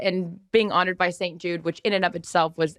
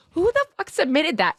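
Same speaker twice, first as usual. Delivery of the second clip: high, incredulous outburst; mock outrage at whoever wrote it; a retold indignant blurt; 10 semitones higher than usual. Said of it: "first of all, I'm like".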